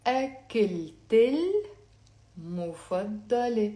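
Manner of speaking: slow, linked أكلت المفضلة, syllables held apart; slow teaching model of أكلت المفضلة; slowly. Shown as a demonstration. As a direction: stretch "ak-kil-tel", then "mufaddale" in أكلت المفضلة. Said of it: "Let's say it slowly".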